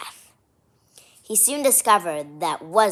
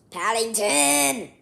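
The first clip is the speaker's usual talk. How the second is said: mock scolding; 4 semitones higher, 4 dB louder, slower than usual. Real, not acted. Reader scolds the bear.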